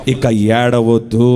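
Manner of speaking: slow, low "ఇక" leaned on gently; tender hush. Retold or imitated. God gently telling his child "ఇక ఏడవద్దు", slow and low. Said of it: "ఈరోజు నీకు మాట ఇచ్చినాడు".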